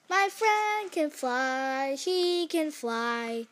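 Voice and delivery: slow, drawn-out announcement in wonder; proclaiming. Acted. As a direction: announce it grandly, stretching each word. Gerald proclaims friend's flight.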